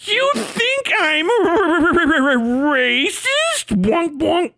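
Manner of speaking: squeaky high cartoon voice; mock him like a cartoon character. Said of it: "he had a fucking cartoon grade reaction".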